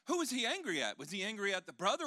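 ordinary talk

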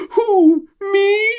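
hammed-up innocent act; feigned innocence. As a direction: squeak it out, playing innocent. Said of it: "innocence of".

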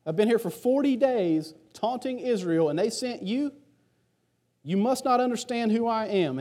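put-on high, scornful giant taunting a boy; mocking Goliath. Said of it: "Goliath looks at David and goes".

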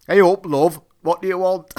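loud, put-on thick Lancashire greeting; thick Lancashire accent. Shop owner's wife greets a customer, imitated thick Lancashire accent. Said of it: "In a thick Lancashire accent".

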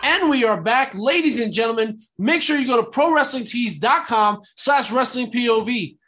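upbeat host announcing the return; announcer return. Acted.

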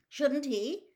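high, anxious question. A narrator's reading. Visitor checks on the oddly dressed man, anxiously. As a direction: ask with a touch of worry. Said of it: "said Mister Weasley anxiously".

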